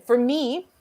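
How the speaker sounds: ordinary talk, nothing marked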